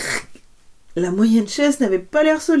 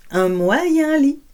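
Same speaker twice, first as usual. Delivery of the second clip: middle bed voice; 7 semitones higher, slower than usual. A narrator's reading. Three beds, big to small.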